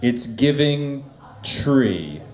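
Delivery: lightly voiced sassy drag queen; a hint of a choosy drag queen. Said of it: "this is my impression of a, a drag queen".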